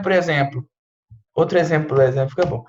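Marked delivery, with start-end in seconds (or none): none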